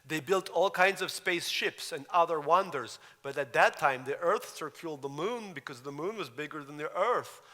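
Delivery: put-on boy reading his story, Russian-accented; child reader with a Russian accent. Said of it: "And then I continue slower".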